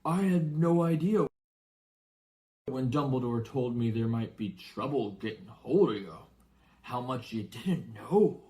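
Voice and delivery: lightly voiced anxious giant; worried giant. Giant worriedly confides in the boy. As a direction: fret over what the boy was never told.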